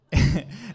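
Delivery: short high burst of laughter. Real, not acted. Speaker laughing to the congregation about no break, bright laugh. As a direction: laugh at how fast it came.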